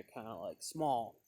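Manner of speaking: usual speaking voice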